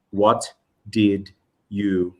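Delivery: slow, word-by-word "What did you". Shown as a demonstration. Teacher asked to say it slowly, each word apart. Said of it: "if you ask me to say it slowly".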